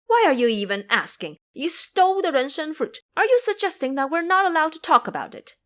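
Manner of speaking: put-on high, loud furious boy; boy raging at the monk; angry retort. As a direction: snap back furiously. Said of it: "Qing Feng retorted".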